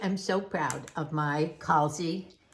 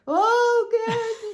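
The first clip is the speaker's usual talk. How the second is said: imitating tearful daughter; 7 dB louder than usual. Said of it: "And she would cry".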